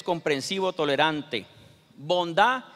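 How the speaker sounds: ordinary delivery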